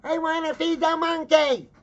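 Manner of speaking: put-on high, piercing spoiled-kid screech; mock little girl shrieking at full blast; screaming child tantrum. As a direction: scream it like a bratty four-year-old. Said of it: "starts screaming at her parents".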